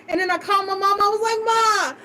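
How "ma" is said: high-pitched distraught plea to mom; imitating helpless crying to her mother; retold crying. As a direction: reenact a tearful phone call, voice way up. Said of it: "I was just crying".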